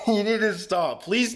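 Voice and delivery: laughing disbelief, high and bright. Real, not acted. Streamer laughing at a donor's big gift. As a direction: laugh through the protest.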